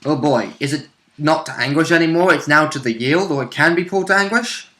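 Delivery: normal talking voice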